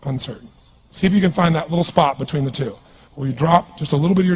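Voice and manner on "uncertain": low, very soft, falling; uncertain. Shown as a demonstration. Coach contrasting the two states for the crowd, uncertain. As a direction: deflate as if doubt took over. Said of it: "the difference between being certain".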